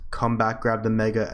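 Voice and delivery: ordinary talk, nothing marked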